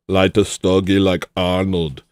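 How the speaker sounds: lightly voiced Austrian accent; a hint of an Austrian accent; Arnold impression. A streamer trying an Austrian accent on a chat request. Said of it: "Sick impression, solid impression".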